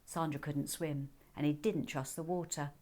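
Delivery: ordinary delivery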